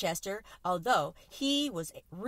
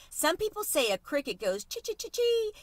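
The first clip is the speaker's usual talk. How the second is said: bright excited cricket, pitched higher; exclaiming; 9 semitones higher than usual. Acted. Cricket exclaiming to his new friends, higher and excited. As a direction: burst out with it, higher. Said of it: "exclaimed Chester".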